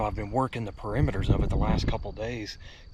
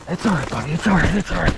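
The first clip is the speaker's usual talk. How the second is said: breathless shaky; 8 semitones higher, 12 dB louder, faster than usual. Real, not acted. Calming himself, breathless.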